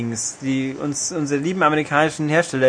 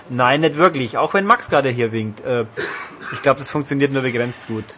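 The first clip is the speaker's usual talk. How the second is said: ordinary delivery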